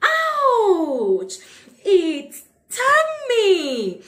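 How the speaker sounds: playful pretend pain yelp; mock ouch. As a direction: act out a sting for the kids. Acted bee sting in song.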